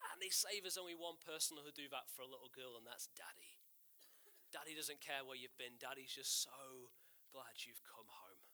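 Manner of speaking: ordinary talk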